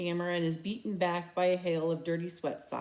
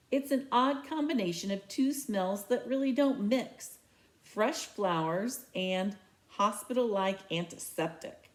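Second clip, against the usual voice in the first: ordinary delivery, nothing marked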